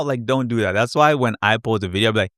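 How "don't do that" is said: normal talking voice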